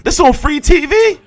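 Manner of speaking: hammed-up shocked teenage fan; mock young viewer hype. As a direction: yell like a stunned teenager. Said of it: "they see this shit ... like".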